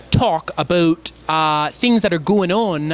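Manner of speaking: slow Canadian accent with stretched vowels. Shown as a demonstration. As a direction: drag out the vowels, Canadian style. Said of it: "can you do your best stereotypical Canadian accent".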